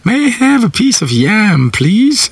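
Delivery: mock a proper tourist asking politely; hammed-up prim tourist asking for yam; posh tourist voice. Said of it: "like her tongue spraying".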